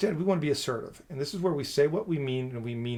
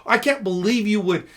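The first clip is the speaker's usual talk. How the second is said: loud, higher, indignant burst; aggressive example; 6 semitones higher, 8 dB louder than usual. Shown as a demonstration. Husband snapping at his wife about dishes, loud and indignant. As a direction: snap at the wife in disbelief. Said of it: "I don't have to be aggressive".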